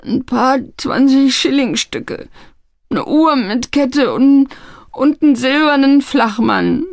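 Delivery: high, dragging, tearful confession; weeping lad owning up; sobbing. A narrator's reading. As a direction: whimper through the list slowly. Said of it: "schluchzte er".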